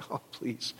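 a weary, dismissive murmur; exasperated aside. Retold or imitated. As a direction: roll your eyes under your breath. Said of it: "I'm just like".

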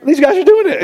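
amazed burst at the wrestlers. Real, not acted. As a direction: exclaim it in disbelief. Preacher amazed at Penn State.

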